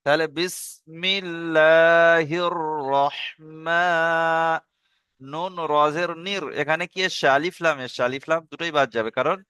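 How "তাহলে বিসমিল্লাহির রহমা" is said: রহমা- held one alif long, slow; one alif stretch. Shown as a demonstration. Teacher drilling the long vowel. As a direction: stretch the মা a beat. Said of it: "এক আলিফ টান".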